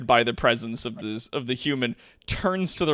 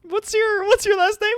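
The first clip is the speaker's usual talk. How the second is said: much higher and louder insistent ask; mock orc question; 6 dB louder than usual. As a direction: squeak it out in a mocking voice. GM repeats orc's question.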